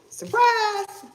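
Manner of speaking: high-pitched, exclaimed surprise; spring it on them in a high voice; mock surprise shout. Mock surprise announcement in reading.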